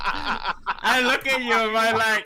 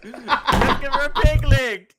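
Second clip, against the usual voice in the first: laughing; 4 semitones higher, 10 dB louder than usual. Real, not acted. Laughing suggestion.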